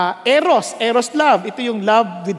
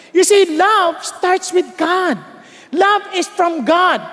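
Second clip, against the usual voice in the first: slow, higher, punched sermon lines; emphatic preaching; 6 semitones higher, slower than usual. Acted. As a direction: preach it slowly and hard. Preaching at full force.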